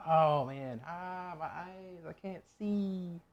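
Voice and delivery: slow, drawn-out pained whine, half hammed-up; slow teary complaint from onion fumes; stinging eyes. Real, not acted. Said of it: "Oh man, I'm crying now".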